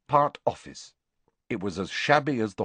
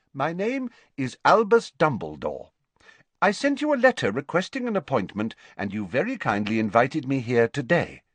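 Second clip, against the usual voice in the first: higher, brisk formal introduction; courteous younger headmaster; 5 semitones higher than usual. Acted. Visitor reminding the matron of his appointment, courteously. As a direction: introduce yourself with polished courtesy.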